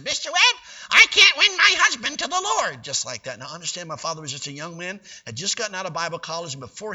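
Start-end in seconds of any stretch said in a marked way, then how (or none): 0.0-2.8 high, loud put-on lady voice; rich lady's voice. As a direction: play the fussy rich lady. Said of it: "mimicked her exact tone of voice".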